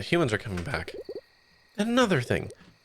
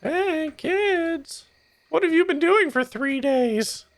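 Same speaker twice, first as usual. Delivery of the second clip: very high, slow, motherly scolding voice; shrill worried mother calling her kids, drawn out; fussy mom voice; 4 dB louder, much slower than usual. Acted. Mom character greets kids.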